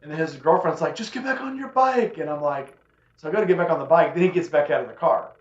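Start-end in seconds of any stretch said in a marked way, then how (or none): 1.0-2.2 put-on much higher, slow girlfriend voice; pleading girlfriend. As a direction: beg him to leave it, pitched way up.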